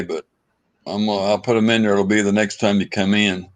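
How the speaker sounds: usual speaking voice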